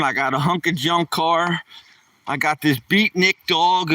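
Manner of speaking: usual speaking voice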